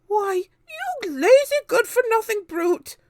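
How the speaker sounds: very high, loud furious shout. A narrator's reading. Aunt Spiker shouting at lazy James, high and loud. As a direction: scream abuse at the boy. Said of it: "Aunt Spiker shouted".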